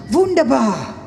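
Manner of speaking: loud, high mock-German exclamation; German guy. German guy in a joke seeing a beautiful girl, loud exclamation. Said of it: "so the German guy goes".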